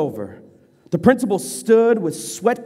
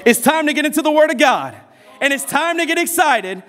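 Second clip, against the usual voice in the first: preaching; 9 semitones higher than usual. Acted. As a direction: lift the room toward praise. Preacher building excitement.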